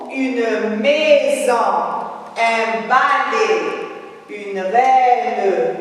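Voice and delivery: very slow, drawn-out syllables; slow model reading. Shown as a demonstration. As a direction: read each word out slowly for young pupils, stretching the vowels. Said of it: "Je relis les mots". (lecture lente, syllabes étirées)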